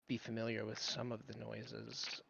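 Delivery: ordinary delivery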